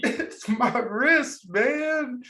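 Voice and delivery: whiny complaint. Retold or imitated. Son answering dad in story.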